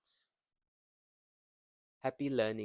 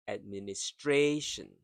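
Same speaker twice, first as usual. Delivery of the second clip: "administration" louder, with a long stressed "stra"; same stress pattern; 6 dB louder than usual. Shown as a demonstration. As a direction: hit and stretch "stra" in "administration". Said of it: "The same as this".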